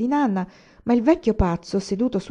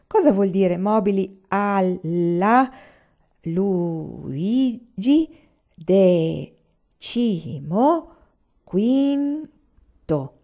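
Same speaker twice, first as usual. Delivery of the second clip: halting reading; 4 dB louder, much slower than usual. A narrator's reading. Old man reads from catalogue.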